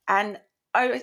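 usual speaking voice

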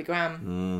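Graham squashed into one syllable, "gram"; American-style Graham. Shown as a demonstration. Co-host echoes the American way, Graham said as "gram". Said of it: "Do they say Graham in Canada as well".

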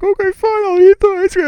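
put-on very high, loud kid wail; squeaky, hammed-up tearful surrender; imitated bawling. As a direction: give in with a loud mock cry. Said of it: "I'm bawling while I'm trying to eat".

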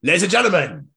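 high, loud hype announcer. Acted. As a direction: introduce them like a ring announcer. Hypes the guests as announcer.

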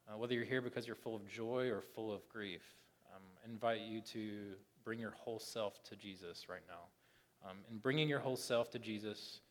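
soft, low, halting prayer; gentle spoken prayer, dropping away; pastoral prayer. Real, not acted. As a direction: pray quietly over the congregation, with pauses.

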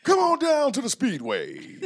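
high, loud, slow race ad read; radio ad announcer. Acted. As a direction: boom it like a Speedway commercial. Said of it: "You sound like a F M D J".

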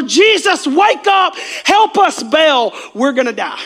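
high, loud cry for help, dropping at the end; panicked shouting. Acted. Disciples waking Jesus in the storm, urgent shouting. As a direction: yell to wake him before the boat sinks.

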